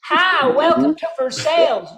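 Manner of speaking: mock Kentucky greeter saying "ver-SALES"; Kentucky local. Local welcomes visitor to town, Kentucky accent.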